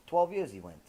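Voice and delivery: ordinary delivery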